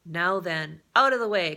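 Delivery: loud commanding call, rising on out of the way; crying out. Acted. Coast Guard leader ordering the fishermen back, loud call. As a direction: clear the crowd with a shout. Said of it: "cried the leader of the Coast Guard men".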